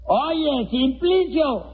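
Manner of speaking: high-pitched call to a deaf man; drawn-up, high hail. Acted.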